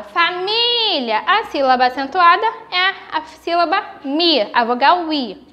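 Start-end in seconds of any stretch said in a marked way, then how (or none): 0.0-1.2 "família" with MÍ held long; called-out "família", long stressed í; calling the word. Shown as a demonstration. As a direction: stretch the middle syllable of "família" as if calling it. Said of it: "Eu chamo pela palavra".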